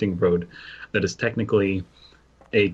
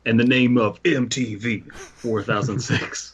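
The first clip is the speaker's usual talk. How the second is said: mock grand proclamation. Reading SCP text grandly.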